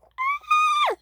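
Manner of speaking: very high thin squeal; scream. Acted. Rosie hit very hard, high squeal. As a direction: squeal at the very top of the voice. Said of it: "she screamed".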